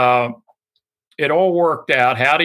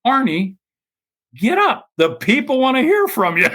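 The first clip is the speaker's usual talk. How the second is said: much higher, pushy mock command; insistent wife; 12 semitones higher than usual. Wife elbowing Arnie to stand, high insistent urging. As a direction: prod him up, shrill and urgent. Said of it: "Winnie shoves an elbow into his side and says".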